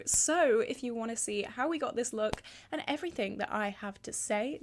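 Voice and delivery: ordinary delivery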